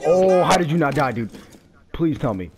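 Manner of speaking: loud amazed how-did-you; shocked shout. Real, not acted. Amazed teammate survived, shouting.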